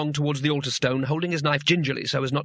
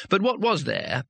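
usual speaking voice

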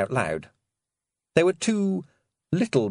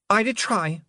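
put-on young Roland voice; 11 semitones higher than usual. A narrator's reading.

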